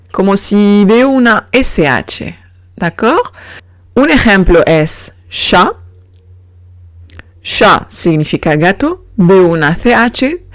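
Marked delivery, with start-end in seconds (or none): 5.3-7.9 "chat" starting with soft sh, final t silent; French soft ch. Shown as a demonstration. Teacher giving an example of French CH, soft sh. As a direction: say "chat" as sha. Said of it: "sino sh".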